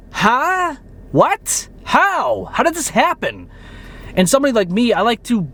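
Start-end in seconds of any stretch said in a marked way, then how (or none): 0.1-3.5 mock confused squeak, much higher; pitched-up baffled "how?"; baffled disbelief. Creator mimicking baffled viewers, high disbelieving squeak. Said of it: "sitting there scratching your head".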